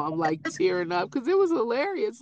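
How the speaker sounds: ordinary talk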